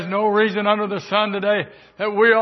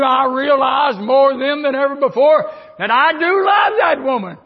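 louder and higher, proclaiming love; rising preacher's declaration; 5 semitones higher, 6 dB louder than usual. Acted. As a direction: proclaim it to the congregation.

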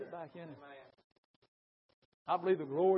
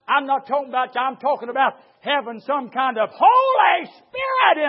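loud, high shouted preaching; fiery preaching; 7 semitones higher, 14 dB louder than usual. Acted. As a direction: thunder it from the pulpit. Preaching at full force.

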